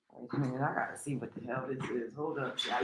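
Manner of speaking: under her breath, distracted; muttering to herself. Real, not acted. Turns away to look at sky.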